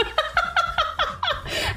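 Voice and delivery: high rapid giggle; helpless high cackle; laughing. Real, not acted. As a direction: burst into high, rattling laughter. Laughing at own mishap.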